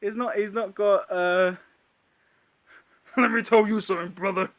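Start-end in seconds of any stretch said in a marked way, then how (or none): 3.2-4.5 loud, wrestler bark; hammed-up Hulk Hogan. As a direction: growl it like a wrestling promo. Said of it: "as Hulk Hogan".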